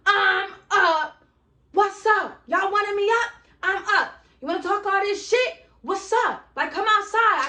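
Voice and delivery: high, loud shouted dare at the door; yelling challenge. Retold or imitated. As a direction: call her out loudly and cocky. Acting out calling her outside.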